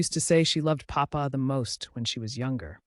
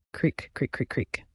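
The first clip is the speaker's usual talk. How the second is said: door creak. Acted. Door sound effect read out.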